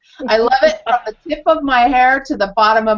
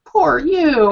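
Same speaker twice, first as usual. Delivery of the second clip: mock pity; 6 semitones higher, 4 dB louder than usual. Shown as a demonstration. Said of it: "the right thing to say to Susan is".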